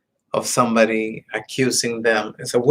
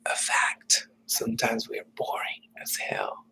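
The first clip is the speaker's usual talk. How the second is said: breathy confiding whisper; 11 dB quieter than usual. Real, not acted. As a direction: let the blunt truth out as a secret. Confiding blunt truth to viewers.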